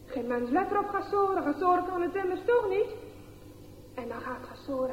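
ordinary delivery

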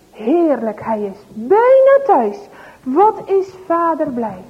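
plain reading voice

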